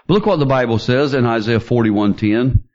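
normal talking voice

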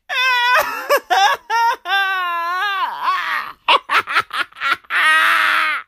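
long high laugh burst; big shouted laugh. Real, not acted. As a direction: crack up at your own line.

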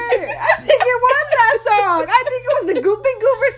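laughing. Real, not acted.

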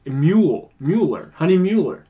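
Mule, Mueller with long "yoo", each stressed then falling; demonstrating the U. Shown as a demonstration. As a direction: drive home the "MYOO" in Mule and Mueller. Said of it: "you say it with the U".

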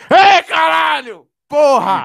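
full-force high victory shout; celebratory yell. Retold or imitated. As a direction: yell it out like a winner. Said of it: "só gritei". (grito de comemoração a plenos pulmões)

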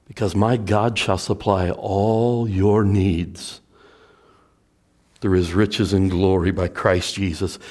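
normal talking voice

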